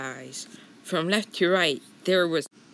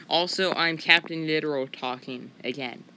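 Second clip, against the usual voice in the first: usual speaking voice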